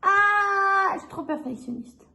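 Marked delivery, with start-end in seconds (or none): 0.0-0.9 long, high, loud "aah"; frustrated cry. Real, not acted. Exasperated at own perfectionism, drawn-out frustrated cry. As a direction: cry out on one sustained high note.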